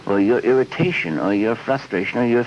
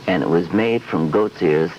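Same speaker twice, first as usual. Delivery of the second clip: ordinary talk, nothing marked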